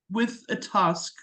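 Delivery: ordinary talk, nothing marked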